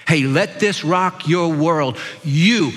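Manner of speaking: usual speaking voice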